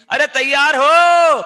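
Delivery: very loud, high, long-drawn call; rallying shout. Real, not acted. Teacher asking pupils if they are ready, rallying shout. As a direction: bellow it slowly to fire up the class. Rouses class with repeated question.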